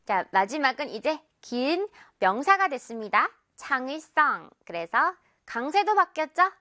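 normal talking voice

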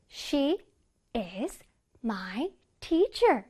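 "She is my teacher" word by word, with pauses; slow, melodic "She is my teacher"; piano-like intonation. Shown as a demonstration. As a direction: play each word of "She is my teacher" as a separate note. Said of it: "피아노 악기 연주하고 있지요".

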